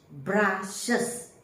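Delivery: BRUSHES split into bru-shes; two-syllable pronunciation. Shown as a demonstration. Teacher showing the plural of brush, two-syllable pronunciation of BRUSHES, bru-shes. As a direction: say "brushes" in two clear beats. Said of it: "it becomes two syllables".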